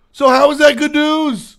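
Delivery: high-pitched disbelief; squeaky outraged questions; incredulous. Retold or imitated. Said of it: "I was unhappy".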